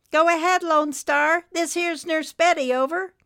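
high, loud CB operator voice; radio nurse. Acted. Nurse Betty answers Lone Star's radio call, high and loud. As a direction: pitch it up and project to the truckers.

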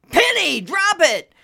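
high, loud yell at a dog; shouted command. A narrator's reading. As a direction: bellow the order in exasperation. Junior shouting at his dog.

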